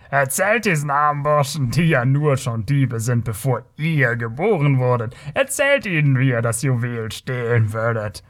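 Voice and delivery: mocking Kothian voice, goading the barbarian; provoking taunt. A narrator's reading. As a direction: needle him in front of the crowd. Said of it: "ihn weiter zu reizen". (spöttisch aufreizender Kotier)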